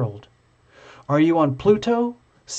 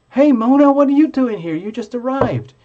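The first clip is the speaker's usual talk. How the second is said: much higher, louder hey Mona; excited greeting; 9 semitones higher, 6 dB louder than usual. Real, not acted. Host greets Mona arriving in the chat, excited and loud. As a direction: call out gladly to a friend.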